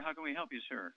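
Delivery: ordinary talk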